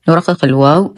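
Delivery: ordinary delivery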